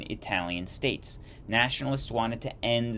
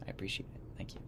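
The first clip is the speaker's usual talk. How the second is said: whisper; 15 dB quieter, much faster than usual. Real, not acted. Whispered aside to viewers.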